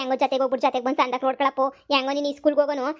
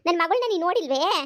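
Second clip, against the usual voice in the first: usual speaking voice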